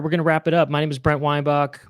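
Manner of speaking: usual speaking voice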